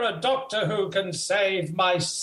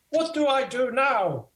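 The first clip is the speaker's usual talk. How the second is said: ordinary delivery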